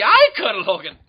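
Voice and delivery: loud shouted German officer; Nazi character voice. Acted. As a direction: bark it like a cartoon colonel. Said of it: "recurring characters is a Nazi".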